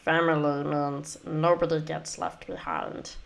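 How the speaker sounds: raspy alien creature voice, fading at the end; Stitch impression. Shown as a demonstration. Streamer doing her best Stitch for viewers, alien rasp. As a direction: become the little blue alien, dropping to a breathy trail-off. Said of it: "I'll do probably the classic line".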